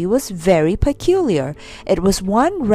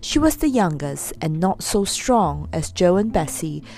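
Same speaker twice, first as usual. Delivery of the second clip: plain reading voice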